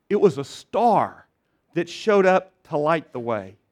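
usual speaking voice

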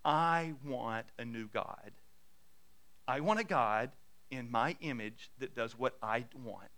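slow, low and soft insistence; measured, defiant demand in a hushed tone; stubborn ultimatum. Acted. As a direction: echo a sulky child's demand, quietly. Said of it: "then you begin to say".

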